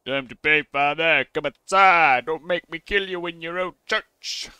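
loud outlaw's demand; hammed-up bandit threat, shouted; threatening bandit. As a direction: call the priest out with menace. Voices bandit threatening priest.

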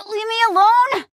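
high, strained cry; effortful angry protest; straining protest. Acted. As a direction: cry out while wrenching free. Grappled by an attacker.

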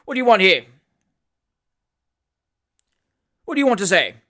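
loud, sharp demand. A narrator's reading. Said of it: "said sharply".

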